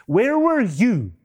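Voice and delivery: slow, high, indignant retold demand; livid. God interrogating Job, retold livid demand. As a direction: hammer the challenge with rising pitch. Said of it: "God was livid".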